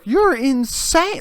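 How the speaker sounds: high, slow, loud accusation; imitated mad outburst. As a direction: draw it out high, acting outraged. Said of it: "Like, getting all mad".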